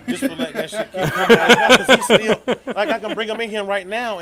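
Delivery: half-laughing loud rant, voice up high; loud exasperated laughing rant. Real, not acted. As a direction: blow up laughing about him. Angry rant about stubborn friend.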